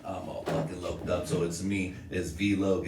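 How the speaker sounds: normal talking voice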